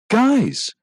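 high, loud burst of discovery; sharp excited shout for attention; sudden exclamation. Acted. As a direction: call the others over, excited. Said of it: "Devin exclaimed suddenly".

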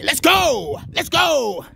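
pumped-up rallying shout; hype shout. Real, not acted. Vlogger hyping up his viewers, excited shout. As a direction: fire yourself up, shout it out.

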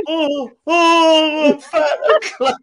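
high, drawn-out comic moaning; mock wail. Host replaying their reaction to being teased, high mock wail. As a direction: wail it in hammed-up self-pity.

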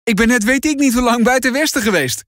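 high, light grinning tone. A narrator's reading. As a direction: tease your brother with a grin. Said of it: "grijnst Noah". (Noah grijnzend tegen zijn broer die hem knuffelt)

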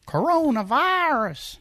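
high, slow "Coronavirus" in a twang; daughter's drawl imitated. Dad imitating his daughter again, slow and high. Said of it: "but it's so funny".

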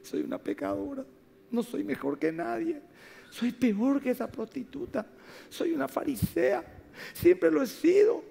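high-pitched mock-weeping confession; wailed, high retold lament, as if in tears; imitating tearful crying. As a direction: act out a woman sobbing out her guilt. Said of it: "viene ella llorando, llorando".

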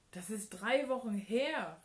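loud, outraged exclamation; indignant shout. A narrator's reading. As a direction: call it out in indignation. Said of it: "rief sie empört". (empört gerufen)

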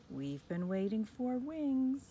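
plain reading voice

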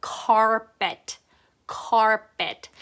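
"carpet" modelled the English way, R sounded; rolled English R. Shown as a demonstration. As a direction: roll slowly through the R in "carpet". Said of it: "혓바닥을 막 굴려서".

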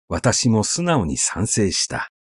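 ordinary delivery

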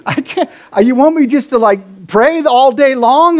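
laughing, half-whining protest to God; mock freaked-out protest. To God, balking at praying without ceasing, laughing protest. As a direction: play the overwhelmed believer balking, a laugh in it. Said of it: "freak out on that scripture".

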